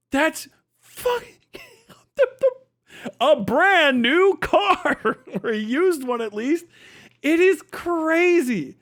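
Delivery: high, slow words through laughter; howling at the absurd cost; laughing hard. Real, not acted. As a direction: crack up mid-sentence. Laughing about wasted money.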